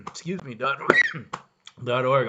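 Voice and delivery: coughing through the words; choked up mid-sentence, coughing; choking on a drink. Real, not acted. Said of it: "I'm choking".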